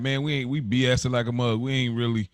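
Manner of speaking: ordinary talk, nothing marked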